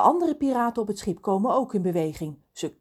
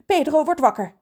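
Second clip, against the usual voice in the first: raised, urgent warning; hurried; 8 semitones higher, 6 dB louder than usual. A narrator's reading. Lorelei warns the rescuers about Pedro, hurried. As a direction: warn them with some haste. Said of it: "zegt ze gehaast".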